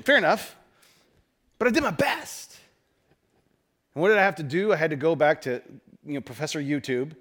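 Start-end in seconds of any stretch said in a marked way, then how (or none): 1.6-2.6 high-pitched, whiny self-defence; wounded protest. Acted. Preacher defending his botched blower repair, mock wounded. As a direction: squeak it out in mock indignation, rising.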